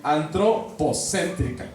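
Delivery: "antropocéntrica" drawn out slowly, part by part; teacher slowly building "antropocéntrica" from its parts; slow sounding-out. Shown as a demonstration. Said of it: "Es una sola palabra".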